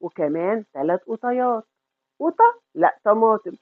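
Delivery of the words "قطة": high, loud startled query; mock surprised question. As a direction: act shocked at the word. تستغرب كلمة قطيات. (استغراب مصطنع عالي)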